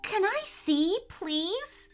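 put-on high-pitched pleading little girl; excited. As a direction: beg eagerly in a child's high voice. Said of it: "Sasha was excited".

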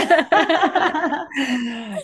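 high, loud burst of laughter; real amused laughter; hearty laugh. Real, not acted. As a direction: burst out laughing at a friend's joke. Laughs after teasing remark about mom.

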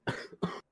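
hacking cough; coughing fit. Real, not acted. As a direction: cough hard, mouth shut. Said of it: "Sorry, I'm coughing so much".

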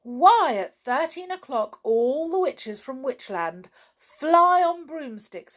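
high, animated pixie voice; shouted explanation. A narrator's reading. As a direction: pipe up urgently like a pixie. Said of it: "shouted all the pixies together".

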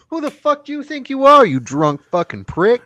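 loud, high furious shout; mock outraged yell. Mister Green yelling at drunk Leahy, loud mock fury. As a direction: scream the insult at the drunk.

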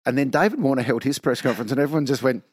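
usual speaking voice

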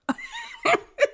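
squealing giggle; high laugh. Real, not acted. Laughing at the plant.

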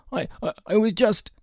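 put-on high, stammering boy; frightened stammer. A narrator's reading. Robber boy making excuses to his attacker, frightened. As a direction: fumble for an excuse, high-pitched. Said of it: "the boy cried, his eyes wide".

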